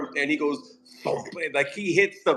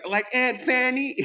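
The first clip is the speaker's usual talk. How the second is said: mock kid voice; 9 semitones higher than usual. Voicing the kid.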